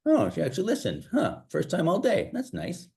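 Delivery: high, light inner voice. Retold or imitated. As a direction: think it in a high, pleased voice. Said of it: "And my brain's like".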